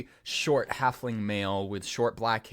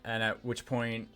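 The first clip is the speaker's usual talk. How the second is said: ordinary talk, nothing marked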